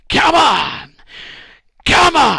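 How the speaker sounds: cheering shout. Retold or imitated. Moses back to urging his army on, loud high shout. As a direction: yell the army on with everything. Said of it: "And so he goes".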